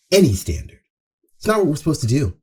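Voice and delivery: ordinary talk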